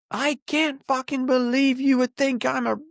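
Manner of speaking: high-pitched mock drunk tirade; hammed-up high, outraged rant; imitating drunken rage. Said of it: "losing it on me".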